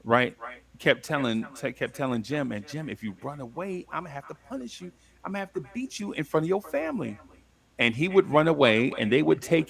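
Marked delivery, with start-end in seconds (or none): 2.5-7.2 retold stern warning. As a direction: quietly mimic the slave owner's warning. Slave owner warning Jim.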